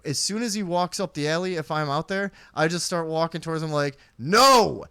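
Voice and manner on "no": loud, high refusal; imitating a loud warn-off; firm mock warning. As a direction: shut him down with a big drawn-out no. Imitates warning off approaching man.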